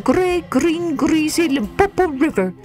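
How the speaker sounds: put-on high, rising childlike voice; a small curious child; young child voice.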